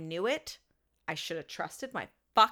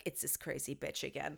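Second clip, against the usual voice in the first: low, much softer grumble to herself; muttered under breath; 6 semitones lower, 12 dB quieter than usual. Retold or imitated. Annoyed at the client's texts, quietly muttering. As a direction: mutter it like the phone just buzzed again. Dreading phone buzz from client.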